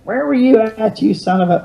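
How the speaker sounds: high, mock scolding yell from the porch; mock cursing. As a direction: ham up a sister cursing him out. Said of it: "cursing at me".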